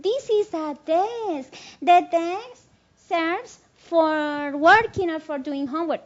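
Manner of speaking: inglés lento y pausado de profesora, con tono más alto; enséñalo a los alumnos, alargando las palabras; profesora en clase. Acted. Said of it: "le decimos a nuestros estudiantes en clase". (profesora explicando despacio en clase)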